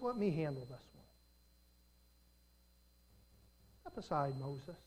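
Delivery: soft, low and firm; calm God. Acted. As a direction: quietly take over the matter. God speaks to Moses.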